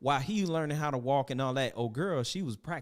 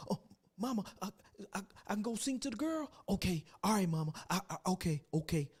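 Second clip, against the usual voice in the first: put-on higher, softer childish stammer; timid eager son; 6 semitones higher, 4 dB quieter, faster than usual. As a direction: stammer like a shy boy asking mama. Son answering mama.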